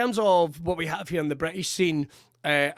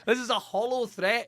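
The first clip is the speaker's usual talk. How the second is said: mock defiance; 6 semitones higher than usual. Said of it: "I was being like".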